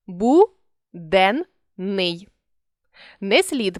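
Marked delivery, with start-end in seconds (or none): 0.1-2.3 Бу-ден-ний said syllable by syllable, pausing between; dictating syllable breaks; split into syllables. Shown as a demonstration. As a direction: pause after each syllable of Буденний. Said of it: "При співпадінні двох однакових звуків".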